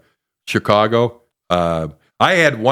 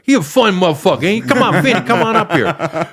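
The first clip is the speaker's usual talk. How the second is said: Tracy Morgan call-out; 9 semitones higher than usual. Retold or imitated. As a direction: bellow it like a rowdy headliner hyping his buddy. Comic impersonating Tracy Morgan calling him.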